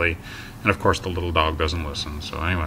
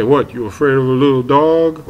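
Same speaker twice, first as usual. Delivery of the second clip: loud, higher sneering jeer; mocking taunt; 7 semitones higher, 9 dB louder than usual. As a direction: taunt him like a smug bully.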